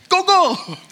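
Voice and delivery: high, quick doubled words like a pushy host. Retold or imitated. Host urging guest to eat, high insistent doubling. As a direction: press food on a guest, high and eager.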